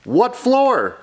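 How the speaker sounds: high, swooping storybook question; high-pitched acted query; urgent questioning. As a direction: ask eagerly for young listeners. Story character asks urgently.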